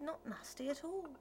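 sharp retort. A narrator's reading. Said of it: "she retorted".

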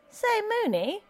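put-on a touchy young husband pressing for news; young husband questioning the alderman.

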